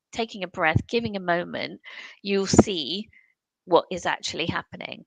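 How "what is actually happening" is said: normal talking voice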